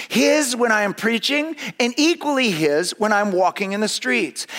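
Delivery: high, loud, slowed, building sermon read; fervent preaching. Acted. Preacher reading Spurgeon's sermon aloud, fervent and rising. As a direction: proclaim it with mounting fervour.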